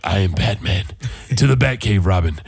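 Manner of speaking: low, gruff superhero voice; Batman. Retold or imitated. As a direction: growl it low as a caped hero. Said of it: "for the rest of the day at work, I'm like".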